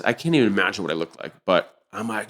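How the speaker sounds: normal talking voice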